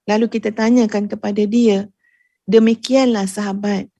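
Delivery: ordinary talk, nothing marked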